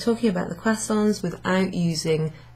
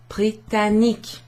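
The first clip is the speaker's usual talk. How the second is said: ordinary talk, nothing marked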